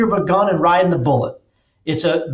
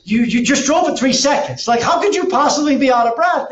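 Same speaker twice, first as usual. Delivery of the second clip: incredulous friend; 9 semitones higher than usual. Retold or imitated. Friend's disbelieving retort retold.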